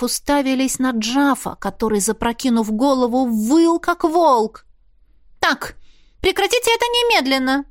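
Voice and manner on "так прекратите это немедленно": sharp, much higher teacher's order to stop; a shrill command to cut it out at once; demanding. A narrator's reading. Marlisa orders Jaf to stop howling, in a high demanding voice. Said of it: "потребовала Марлиза".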